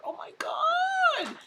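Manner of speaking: stunned, slow whisper of disbelief; hushed amazement, high and drawn out; awed whisper. Real, not acted. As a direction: breathe it out in awe.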